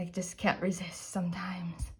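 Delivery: hushed whisper. Real, not acted. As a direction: breathe it as a secret. Whispered confession about polish.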